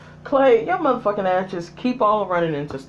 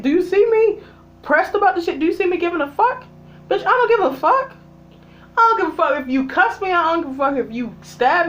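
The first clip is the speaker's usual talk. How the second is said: heated defiance; 8 semitones higher than usual. Real, not acted. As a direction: hammer home that nothing fazes you. Heated rant at a character.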